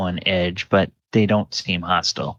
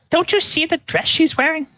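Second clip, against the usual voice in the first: much higher, chiding country wife; farm wife voice. Acted. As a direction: scold him in a high voice. Wife elbows farmer.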